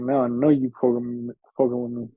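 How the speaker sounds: ordinary talk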